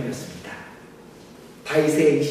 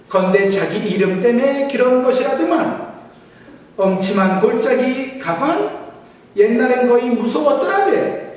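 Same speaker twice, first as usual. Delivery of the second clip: high, loud Pyongan dialect with teasing questions; 8 semitones higher, 8 dB louder than usual. Pretend. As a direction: rattle it off like a proud northern uncle. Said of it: "평안도 버전입니다". (높고 크게 과장한 평안도 사투리)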